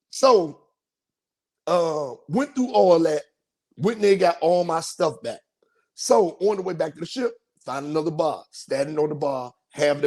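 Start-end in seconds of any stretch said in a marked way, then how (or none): none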